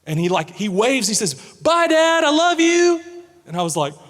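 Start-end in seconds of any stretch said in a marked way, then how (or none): 1.3-3.0 high, loud, carrying goodbye; calling out loud. Retold or imitated. Son waving goodbye to dad from lunch line, loud and high. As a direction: shout it across a crowded room.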